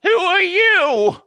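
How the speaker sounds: high, slow, loud grandma voice; confused grandma. Acted. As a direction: draw it out as a grandma who doesn't know you. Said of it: "She looks at you and says".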